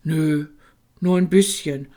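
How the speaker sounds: ordinary delivery, nothing marked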